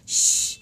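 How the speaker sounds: loud unvoiced shh; shushing. Shown as a demonstration. Teacher shushing to open the silent letter game. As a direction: hush them with a sharp shh. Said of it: "game खेलते हैं जिसका नाम है श्श".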